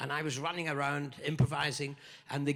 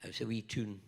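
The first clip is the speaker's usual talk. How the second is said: put-on broad Scottish accent, rhyming playfully; broad Scottish rhyme; 3 semitones higher than usual. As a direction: recite it like a Scottish nursery rhyme. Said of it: "I'll translate my Scottish".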